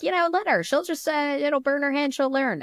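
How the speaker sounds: high-pitched mock-casual dad voice; imitated breezy partner, high voice; nonchalant dad.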